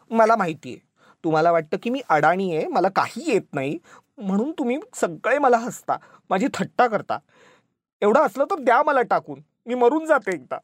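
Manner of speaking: high-pitched, tearful indignation; wounded wife working herself up; hurt outburst. A narrator's reading. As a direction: build to a melodramatic wail of grievance. Wife's furious, hurt outburst before weeping.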